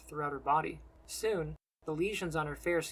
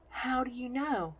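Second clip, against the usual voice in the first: high, slow wondering question; questioning nun; 8 semitones higher, slower than usual. A narrator's reading. Nun questions how the stranger knows, high slow voice. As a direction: ask it high and drawn out. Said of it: "she questions".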